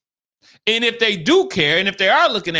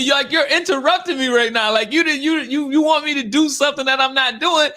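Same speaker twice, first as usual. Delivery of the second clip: frustrated retort; 8 semitones higher than usual. Retold or imitated. Scolding the clingy gym client, high and exasperated. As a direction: raise the pitch and let the exasperation pour out.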